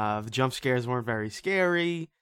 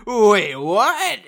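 loud, high "wait, what"; shocked; 7 semitones higher, 10 dB louder than usual. Retold or imitated. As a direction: burst out in disbelief, laughing. Shocked reaction to plot twist.

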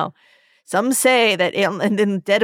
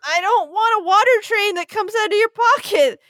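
put-on whimpering child; 7 semitones higher than usual. Scared kid refusing puppet.

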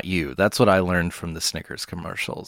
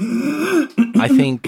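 high mock voice; 4 dB louder than usual. Mock apology reaction, high voice.

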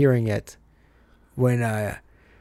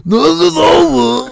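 shouting; 8 dB louder, much slower than usual. Acted. Movie quote shouted back.

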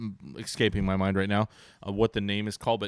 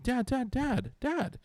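put-on much higher child voice repeating Dad; imitating insistent son; 3 dB quieter, slower than usual. Seven-year-old son interrupting dad, high insistent child voice. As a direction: kid tugging for attention with Dad Dad. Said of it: "He's like".

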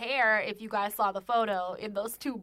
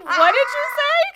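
incredulous what; 11 dB louder than usual. Retold or imitated. Friend retelling Mark's drunk words, high incredulous squeal. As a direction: squeal it in stunned disbelief.

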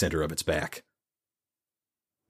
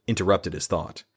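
ordinary delivery, nothing marked

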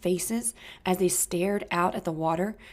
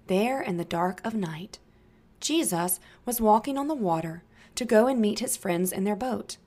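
usual speaking voice

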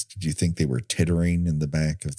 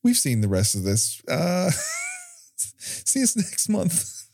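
mock sign-off; 13 semitones higher than usual. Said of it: "we just said".

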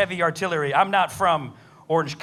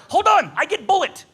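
mock Russian accent; 8 semitones higher, 5 dB louder than usual. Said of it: "He goes".

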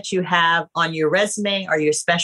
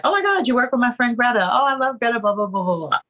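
quick, high gush over a shared friend; gushing excitement; 5 semitones higher, much faster than usual. Retold or imitated. Casting director gushing over a resume, excited. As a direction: rush out the delight, then trail off in blahs. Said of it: "I'm like".

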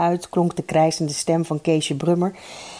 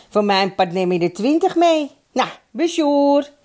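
slightly raised voice, slowing into the sign-off; lightly voiced phone caller; 8 semitones higher than usual. A narrator's reading. Mannes ends his phone call to Eddy, lightly voiced caller.

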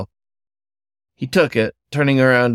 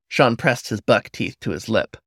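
plain reading voice

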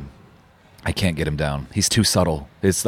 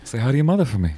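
higher-pitched friendly actor impression; Mark Wahlberg; 6 semitones higher, faster than usual. Guest answering the co-host's comparison, playful celebrity impression. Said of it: "a nicer Mark Wahlberg".

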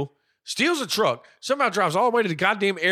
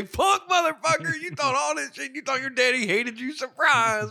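mock prank host; 8 semitones higher than usual. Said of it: "Ashton Kutcher jumped out".

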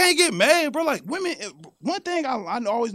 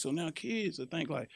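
usual speaking voice

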